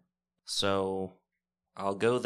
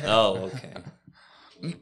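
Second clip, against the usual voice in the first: sarcastic acceptance; 12 dB louder, much slower than usual. Pretend.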